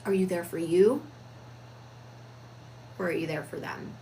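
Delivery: long pause before the second question; deliberate pause after the first question; pause between questions. Shown as a demonstration. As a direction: let the silence sit before the second question. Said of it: "Do you see the pause that was put in there?".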